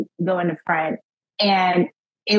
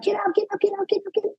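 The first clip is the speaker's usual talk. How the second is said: urgent hushed plea; 11 semitones higher, 4 dB quieter, much faster than usual. Retold or imitated. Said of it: "shaking him".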